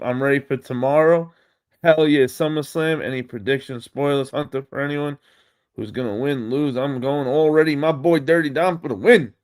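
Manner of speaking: ordinary delivery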